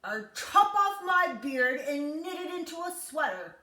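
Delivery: lightly voiced croaky old man; little man promising Jack, loud; croaking little man. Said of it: "croaked the little man".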